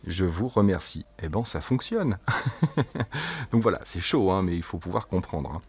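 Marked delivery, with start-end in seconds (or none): 2.2-3.5 high rapid chuckle; real amused giggle; pleased laugh. Real, not acted. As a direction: laugh because it actually worked. Laughs that the translation works.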